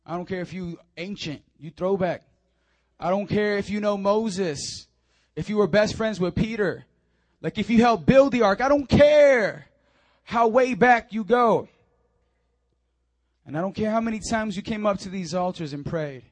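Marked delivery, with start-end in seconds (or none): 3.0-14.6 building preacher's cry. Real, not acted. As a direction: stretch the words out as it swells.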